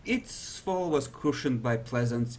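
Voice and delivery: ordinary talk, nothing marked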